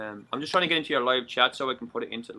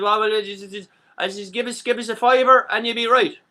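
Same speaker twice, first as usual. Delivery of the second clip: Irish seller voice; 8 semitones higher, 7 dB louder than usual. Retold or imitated. Irish seller names price.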